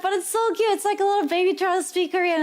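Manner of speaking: high, quickened gushing; acted cooing over a learner. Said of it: "squeeze their cheeks and it's like".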